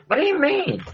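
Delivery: put-on high-pitched valley-girl whine of a question; mock valley girl. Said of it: "I sound like a valley girl".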